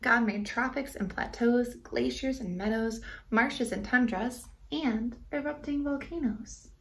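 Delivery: rhythmic rhyming read-aloud; story narration. A narrator's reading. Reading a rhyming book to kids, gently lilting. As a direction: read the verse to children, bouncing the rhymes.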